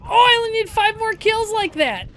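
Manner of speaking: loud, high, thrilled; excited shout. Real, not acted. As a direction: gush over the lucky streak. Excited after kills.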